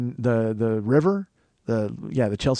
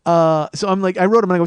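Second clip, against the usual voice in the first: normal talking voice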